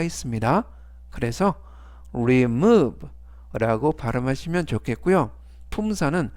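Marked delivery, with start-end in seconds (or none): 2.1-3.1 "remove" punched on MOVE, then falling; careful model "remove", second syllable stressed. Shown as a demonstration. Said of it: "이때 강세는 알파벳 O의 강세가". ("remove"의 O에 강세를 주어 또렷하게)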